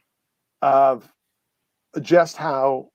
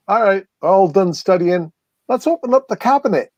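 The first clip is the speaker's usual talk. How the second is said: eager; 6 semitones higher than usual. Retold or imitated. Quoting class winding down.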